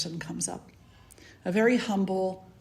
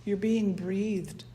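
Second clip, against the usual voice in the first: guided meditation; 7 dB quieter than usual. Acted. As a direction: ease them into the breath, gently.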